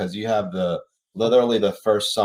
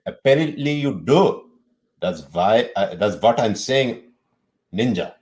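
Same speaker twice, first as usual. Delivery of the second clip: put-on Indian accent; 2 semitones higher, 5 dB louder than usual. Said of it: "read some of the text in your Indian accent".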